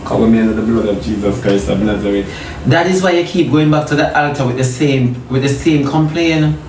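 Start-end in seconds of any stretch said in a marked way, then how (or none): 0.0-2.3 low, quick prayer under the sermon; muttered prayer. Real, not acted. Preacher asks God for covering, low rapid prayer. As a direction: drop the pitch and run the prayer along.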